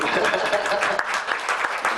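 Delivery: a high, breathy laugh of emotion; moved laugh. Real, not acted. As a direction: laugh through the feeling. Moved laugh amid memories.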